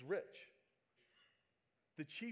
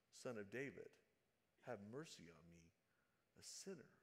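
lower, much softer humble plea; hushed, reverent prayer; quiet plea; 6 semitones lower, 9 dB quieter than usual. Retold or imitated. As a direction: say it softly, as a confession. Preacher re-reads the beggar's plea.

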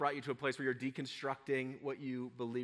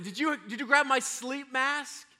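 put-on child asking; 9 semitones higher, 4 dB louder than usual. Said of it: "the other one said".

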